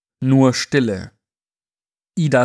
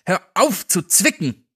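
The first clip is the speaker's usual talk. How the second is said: annoyed shout; 7 semitones higher than usual. Acted. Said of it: "rief ein Mann".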